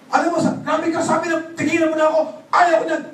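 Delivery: mock yell telling him to stop; retold angry shout. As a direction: boss yelling at a pushy recruiter, hammed-up anger. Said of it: "Galit na galit ako eh".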